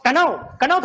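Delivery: कनाव with the k sounded, ka-naav; silent k pronounced. Shown as a demonstration. Teacher mocking the silent k in know. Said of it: "के नहीं बोल रहे हम".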